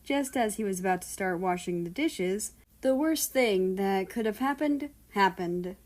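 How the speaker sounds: ordinary delivery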